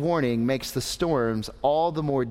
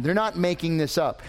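normal talking voice

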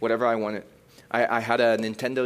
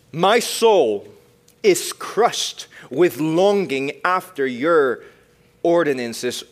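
slow, louder, weighty reading; dramatic scripture reading; 5 semitones higher, 7 dB louder, much slower than usual. Acted. Preacher reading Psalm 119 to the youth, slow and weighty. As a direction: lean into every word of the verse.

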